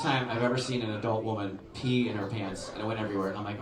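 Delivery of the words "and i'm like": ordinary talk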